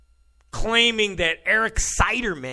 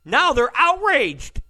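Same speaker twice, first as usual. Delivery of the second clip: "outraged" said slow and louder; indignant stress; 6 semitones higher, 5 dB louder, slower than usual. Real, not acted. Host scorns the libs, pressing hard on "outraged". Repeats outraged, then mocks it.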